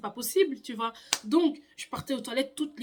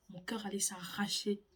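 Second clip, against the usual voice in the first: whisper; 3 semitones lower, 9 dB quieter than usual. Real, not acted. Dramatic hushed climax.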